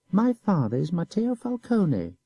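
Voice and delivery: slow, high, each word weighted; proud emphasis. A narrator's reading. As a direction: stress 'My father' proudly. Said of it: "He repeated".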